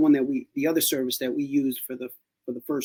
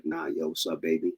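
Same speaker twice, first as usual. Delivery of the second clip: much lower, softer, deadpan greeting; stone cold; 12 semitones lower, 8 dB quieter than usual. Shown as a demonstration. Mocking a cold person greeting a baby, low and deadpan. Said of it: "if you're just stone cold".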